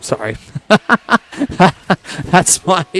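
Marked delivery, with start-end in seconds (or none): 0.4-2.2 high squawky cartoon duck voice; quick squawky duck noise; Donald Duck quack. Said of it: "very on the spot Donald Duck impression".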